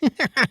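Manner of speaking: high nerdy giggle; short high-pitched nerd laugh; nerdy chuckle. A narrator's reading. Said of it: "let out a nerdy chuckle".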